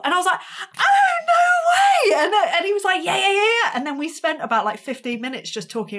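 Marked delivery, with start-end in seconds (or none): 0.8-2.2 huge high, drawn-out shout; thrilled shriek. Retold or imitated. Musician learns the actor drums, thrilled shriek. Retelling thrilled reaction to drummer. 3.0-3.7 high, loud string of yeahs; match the excitement, imitating an enthusiast; eager agreement. Actor confirms he drums, excited.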